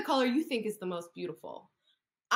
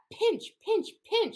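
playful pinch; 11 semitones higher, 4 dB louder, much slower than usual. Acted. Acting crab claws.

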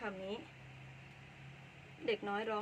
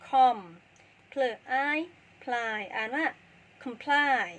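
comply sounded out syllable by syllable, much louder; loud spelling out; 7 dB louder, slower than usual. Shown as a demonstration. Teacher loudly sounding out comply for the class.

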